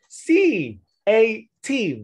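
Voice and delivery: slow, high, loud letter-by-letter spelling; teacher spelling C A T aloud, one letter at a time; spelled out letter by letter. Shown as a demonstration. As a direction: spell each letter C A T out slowly for the class. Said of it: "For instance".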